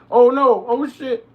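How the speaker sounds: high, loud panicked cry; alarmed yell. Real, not acted. Streamer in sudden danger in the game, alarmed yell. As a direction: caught off guard, yell it.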